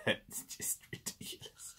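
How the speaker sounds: breathless awed whisper. Real, not acted. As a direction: hush it, in awe. Marvelling at the toy.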